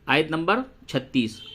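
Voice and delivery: ordinary delivery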